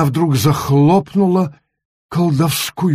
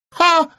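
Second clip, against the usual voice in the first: sharp, much higher contemptuous scoffing ha; little witch scoffing at the ban; scornful ha. Acted. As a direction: spit out a scornful laugh. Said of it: "презрительно крикнула маленькая баба Яга".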